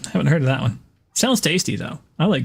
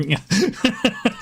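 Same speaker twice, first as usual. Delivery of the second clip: laugh; 10 semitones higher than usual. Real, not acted. Laughing at own impression.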